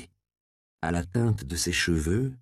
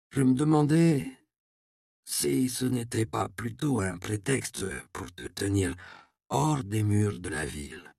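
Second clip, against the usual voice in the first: put-on gentle old man; 4 dB quieter than usual.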